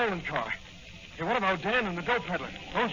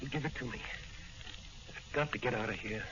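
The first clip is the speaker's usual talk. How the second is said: soft, low, determined plea; weak but urgent; 6 semitones lower, 9 dB quieter than usual. Acted. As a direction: push past weakness to insist. Weak patient pleading for capsule.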